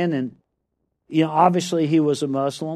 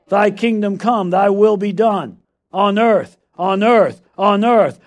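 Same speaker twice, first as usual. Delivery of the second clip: prayer recitation; 2 semitones higher, 6 dB louder, slower than usual. Acted.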